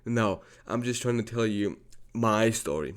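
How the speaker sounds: ordinary talk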